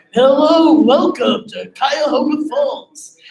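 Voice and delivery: loud, high, drawn-out show announcer; big imitated show-opening voice. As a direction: boom out a stretched welcome to the crowd. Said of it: "Then a voice was like".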